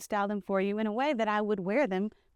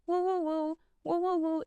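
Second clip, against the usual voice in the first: high cooing; 8 semitones higher, 4 dB quieter than usual. Acted. Consoling sad viewer goodbye.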